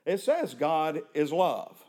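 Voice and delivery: "says" leaned on, slow and deliberate; slow stress on says. Shown as a demonstration. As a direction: spell out what it "says", slowly. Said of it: "that's not what it says".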